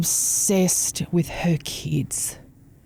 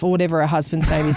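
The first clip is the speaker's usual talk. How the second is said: ordinary talk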